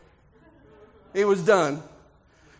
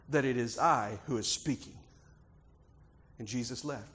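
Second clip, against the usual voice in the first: low, soft, grave words of Jesus; solemn storytelling; 5 semitones lower, 5 dB quieter than usual. A narrator's reading. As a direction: lower the voice and let each phrase settle. Hushed retelling of Jesus' words.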